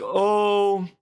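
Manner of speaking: hammed-up lament for a lost love; mock mourning. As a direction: act out mourning with a gasp. Mourning her at the grave.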